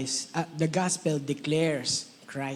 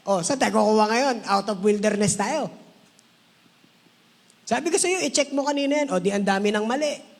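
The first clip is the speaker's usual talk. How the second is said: much higher, fast nagging; nagging spouse; 5 semitones higher than usual. Retold or imitated. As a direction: nag like an impatient partner. Continues nagging quote. (mataas at mabilis na pagmamaktol)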